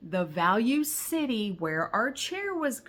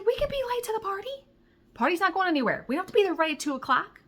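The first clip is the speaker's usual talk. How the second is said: high, fast, breathless arguing; high-pitched fast pleading; frantic pleading; 11 semitones higher, much faster than usual. Retold or imitated. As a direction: rush through it, desperate.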